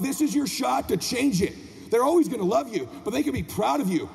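high, driving motivational sermon; motivational preaching. Acted. Speaker challenging parents to be their children's hero, impassioned preaching. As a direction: drive each phrase home like a preacher. Motivational speech at full force.

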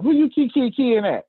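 high, loud mock challenge; local Southern woman's scolding. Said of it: "that's how they say it down here".

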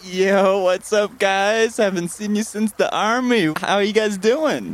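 put-on high-pitched, hearty hello to old buddies; excited reunion greeting. Mister Pickles meets his old army friends, excited greeting. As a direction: hail your old pals, voice lifted.

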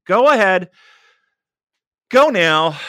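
loud, pushy call to act; loud urging. Acted. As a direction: urge them on, loud. Urging listeners loudly.

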